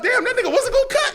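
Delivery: very high, loud astonished realisation; acted disbelief. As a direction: exclaim it in laughing, mock shock. Acted astonished thought after choking.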